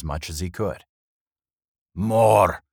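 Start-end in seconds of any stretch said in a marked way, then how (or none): 1.9-2.6 loud, demanding growl; dwarf growl. A narrator's reading. Thirsty dwarf demands another tankard, growling. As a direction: growl it out, greedy for drink. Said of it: "he growled".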